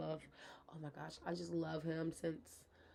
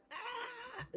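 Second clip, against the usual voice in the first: shrill mock shriek; 5 dB quieter than usual. Imitating her own outburst.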